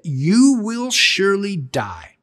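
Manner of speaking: slow, grave divine warning; solemn voice of God. Retold or imitated. Preacher quoting God's warning to the first humans, grave and slow. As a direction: let God's verdict land slowly. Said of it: "I'm quoting God now".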